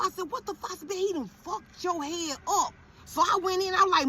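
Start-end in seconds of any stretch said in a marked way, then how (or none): 0.3-0.7 high shocked gasp of a question; shocked outburst. Retold or imitated. Mother seeing son's bad haircut, high shocked what. 0.9-2.7 slow, high, disgusted complaint; indignant complaint. Retold or imitated. Mother telling barber he ruined it, slow high indignation.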